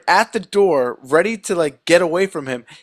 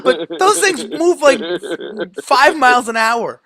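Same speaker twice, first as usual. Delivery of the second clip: ordinary talk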